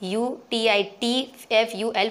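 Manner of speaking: letters of beautiful spelled slowly one by one, B U T I T F U L; slow classroom spelling, letter by letter; teacher spelling B U T I T F U L aloud slowly. Shown as a demonstration. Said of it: "क्या बना".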